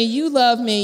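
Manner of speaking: ordinary talk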